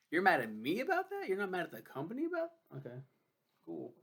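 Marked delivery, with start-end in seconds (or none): 0.1-2.5 higher, louder, sharply indignant; indignant outburst. Real, not acted. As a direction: fire back in disbelief. Outraged at coworker's reaction.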